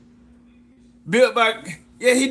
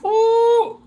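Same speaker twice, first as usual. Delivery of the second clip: excited whoop; 4 dB louder than usual. Real, not acted. Excited cheer on stream.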